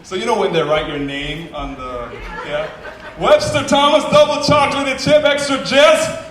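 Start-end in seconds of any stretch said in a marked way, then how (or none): 3.2-6.3 high, loud barista call-out; calling out order. Retold or imitated. Barista announces his order to the whole shop, loud call.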